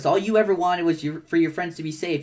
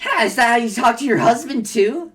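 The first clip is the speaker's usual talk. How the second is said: higher, louder sneering taunt; mocking jab; 5 semitones higher, 6 dB louder than usual. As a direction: sneer it to wound her. [name] mocking Jane.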